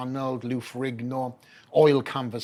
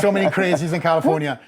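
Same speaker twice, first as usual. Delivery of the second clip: louder, laughing through the words; 5 semitones higher, 12 dB louder than usual. Real, not acted. Retelling the supermarket yell, laughing loudly. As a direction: repeat the joke, laughing hard.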